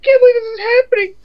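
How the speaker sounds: high, shrieking, hammed-up panic; hysterical damsel. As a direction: ham up a frantic helpless heroine. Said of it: "Then it's like".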